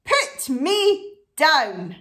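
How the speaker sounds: loud storybook rabbit order; indignant shout. Acted. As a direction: shout each word slowly and separately. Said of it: "shouted the rabbit".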